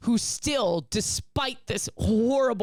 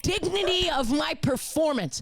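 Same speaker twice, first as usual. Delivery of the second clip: slow, higher, indignant rant; mock outrage; 9 semitones higher, slower than usual. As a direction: demand respect from the crowd, half in jest. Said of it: "And I'm like".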